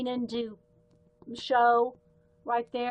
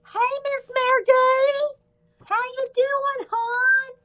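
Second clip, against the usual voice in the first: put-on Southern accent; 13 semitones higher, 7 dB louder than usual. Greeting a friend who came in, exaggerated Southern accent. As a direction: lay the Southern accent on thick, pitched way up. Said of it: "Southern accent unless I put it on".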